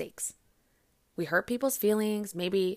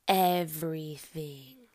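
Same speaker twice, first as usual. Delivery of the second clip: drawn-out indulgent; 5 semitones lower, much slower than usual. Retold or imitated. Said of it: "you were like".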